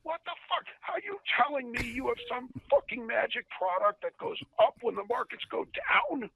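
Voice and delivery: high-pitched, incredulous mock tirade; imitated boss blowing up; yelling boss. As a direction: rant in outraged disbelief, voice way up. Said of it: "Why are you yelling at me".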